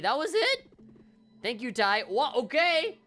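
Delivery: high, loud disbelief; incredulous outburst. Real, not acted. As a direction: blurt it out, pitch shooting up on the question. Incredulous at the anticlimactic boss fight.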